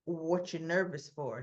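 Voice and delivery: hint of a cold gangster; menacing mob boss. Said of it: "he goes".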